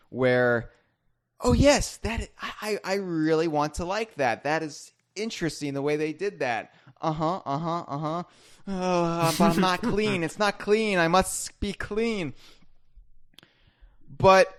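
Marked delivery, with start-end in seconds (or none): 1.4-12.3 lightly voiced fussy inner self, higher pitched; obsessive inner voice. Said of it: "O C D center of my brain".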